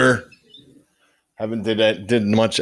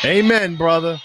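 excited; 8 semitones higher, 3 dB louder than usual. Real, not acted. Cheering after rally clip.

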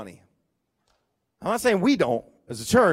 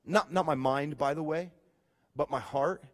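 normal talking voice

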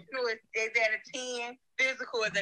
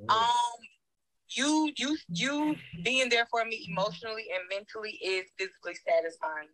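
ordinary delivery

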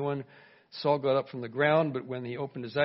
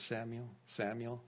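much softer, distant calling voice; soft divine call; 3 semitones lower, 11 dB quieter than usual. Acted. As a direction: call gently as if from far away. Voice of God calling Samuel.

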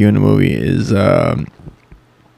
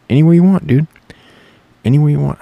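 loud, higher boastful yell; hammed-up boast, raised voice; mock shout; 5 semitones higher, 6 dB louder, much faster than usual. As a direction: yell the challenge proudly. Shouted boast about playing.

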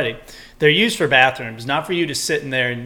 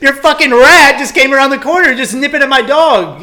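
much higher and much louder, shouting in disbelief; retold furious yell; 11 semitones higher, 11 dB louder than usual. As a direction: blow up at the careless owner, dragging out what happened. Retold shouting at the dog owner.

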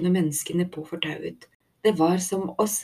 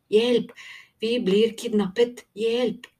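calling for help; 5 semitones higher, slower than usual. A narrator's reading. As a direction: cry out desperately for help. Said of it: "Tico fortsatte å rope". (roper desperat om hjelp)

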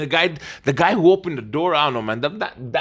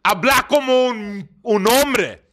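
gruff doorman in Spanish; 8 semitones higher than usual. Retold or imitated. Spanish doorman mocking the boy to talk like a man. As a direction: play the scornful doorman, half laughing. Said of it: "And then he said".

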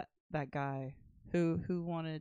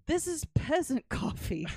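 raised, haughty mock outrage; disdainful rich kids; 10 semitones higher, 5 dB louder than usual. Rich kids rejecting instant coffee, mock disdain. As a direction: pitch it high and loud in mock disgust. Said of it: "And they're like".

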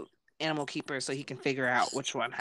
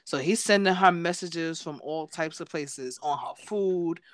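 normal talking voice

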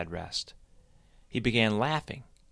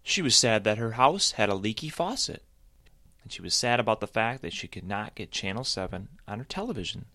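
plain reading voice

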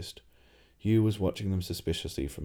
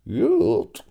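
a strained high croak; strangled croak of shock; 9 dB louder than usual. Acted. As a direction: croak it out, choking on the word. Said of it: "croaked Rincewind".

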